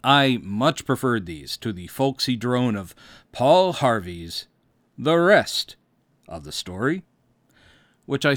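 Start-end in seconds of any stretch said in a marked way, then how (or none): none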